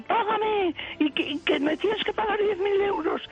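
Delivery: high, insistent mock demand; extortioner demanding. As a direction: press the demand, pitched high. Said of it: "Ella largó".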